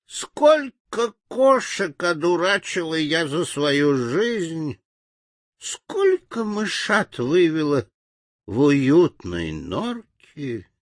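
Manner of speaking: high, slow toothless granny; sweet old lady. A narrator's reading. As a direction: mumble proudly like an old granny. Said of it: "прошамкала старушка беззубым ртом".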